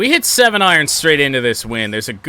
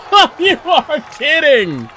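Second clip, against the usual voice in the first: surprised; 6 dB louder than usual. Real, not acted. Shot nearly goes in.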